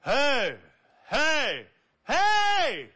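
rising to a high, loud hey; loud test calls. Shown as a demonstration. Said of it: "Try talking".

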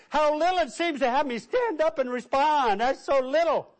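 high-pitched, mildly indignant objection; mock indignant protest. Offended churchgoer objecting to the preacher, mock indignation.

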